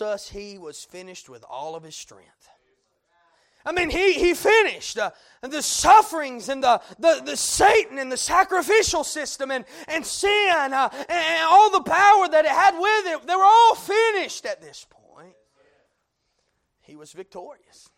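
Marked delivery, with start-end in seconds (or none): 3.6-15.3 loud, high preaching climax; full-force preaching. Acted. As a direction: drive it home from the pulpit.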